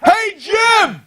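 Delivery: high, loud greeting yell across the room; imitated locker-room shout; mock shout. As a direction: holler a buddy's name. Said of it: "when I heard".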